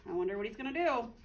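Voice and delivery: plain reading voice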